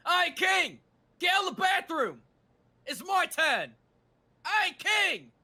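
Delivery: high, loud play-acted yell; calling out. Impatient one yelling King out of the bathroom, high shouted call.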